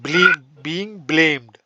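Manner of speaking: drawn-out phrase for students to copy; teacher slowly spelling out a new phrase for the class; slow dictation. Shown as a demonstration. Lay the words down slowly, one by one. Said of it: "teacher dictates phrase".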